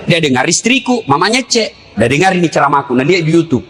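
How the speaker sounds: ordinary talk, nothing marked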